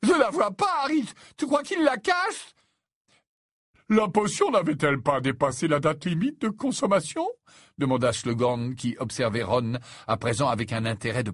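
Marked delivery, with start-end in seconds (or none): 0.0-2.5 very high, louder lovesick whine; whining moan. Acted. Lovesick Ron searching for his beloved, whining. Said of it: "lancer d'un ton gémissant". 3.9-7.3 high-voiced, fussy questioning; put-on professor's voice, pitched up; inquisitive old professor. Slughorn asking Harry about the potion, inquisitive.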